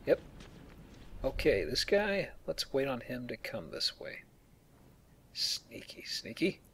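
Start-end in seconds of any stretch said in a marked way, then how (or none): 2.5-6.6 whispering while sneaking; stealthy whisper. Real, not acted. Player sneaking up on a guard, whispering. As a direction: hush it, creeping up on a guard. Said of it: "Oh, so stealth".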